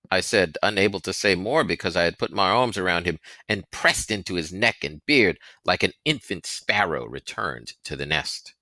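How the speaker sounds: plain reading voice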